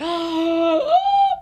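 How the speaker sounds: big high swooning gasp; loud acted gasp of adoration; smitten gasp. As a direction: gasp as if dazzled by love. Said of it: "And I'm like".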